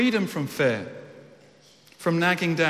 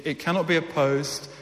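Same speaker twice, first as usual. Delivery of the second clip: ordinary talk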